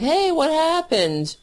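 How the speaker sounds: high, whiny clueless voice; mock clueless voter. Clueless Trump voter pleading with Canadians, high and whiny. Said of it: "will be like".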